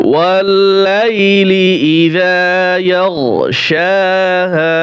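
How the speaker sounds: slow melodic tilawat, each word drawn out; measured devotional chanting; Quran recitation. Acted. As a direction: chant the verse with long held vowels.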